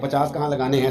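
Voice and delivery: usual speaking voice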